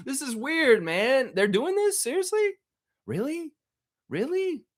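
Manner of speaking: high, incredulous squeak; high disbelief. Real, not acted. Disbelief at team nickname.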